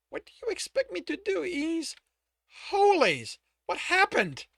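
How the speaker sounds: high, shocked woman's voice. Acted. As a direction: gasp out the shock.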